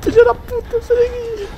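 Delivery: high frantic screams of fright; panicked screaming. Real, not acted. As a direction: shriek in real panic. Chased by monster in game.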